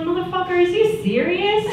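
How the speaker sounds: high-pitched retold disbelief; mock exasperation. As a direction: pitch it up, acting fed up with him. Retold exasperation at his questions.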